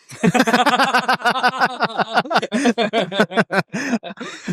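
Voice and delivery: big high laugh; long high laugh. Real, not acted. Laughing at his own story.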